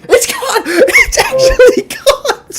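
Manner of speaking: very high, loud squeal breaking into laughter; excited shriek. Real, not acted. Announcer sees the fake punt caught, excited shriek. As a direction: shriek the catch in real, laughing disbelief.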